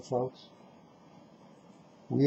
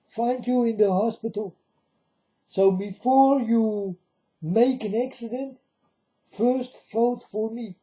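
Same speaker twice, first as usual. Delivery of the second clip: playing a smug politician making a veiled threat; mock Trump voice; 7 semitones higher than usual. Speaker quoting Trump to his viewers, in a mock Trump voice. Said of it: "Like Trump said".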